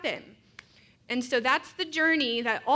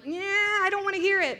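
high-pitched reluctant dodge; reluctant brush-off; 8 semitones higher than usual. Retold or imitated. Speaker retelling how she dodges the news, high reluctant brush-off. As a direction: wave it away in a raised, drawn-back voice. Acting out her avoidant reply.

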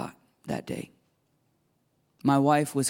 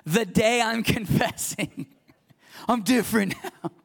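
amused disbelief; 9 semitones higher than usual. Real, not acted. Laughing at his own claim.

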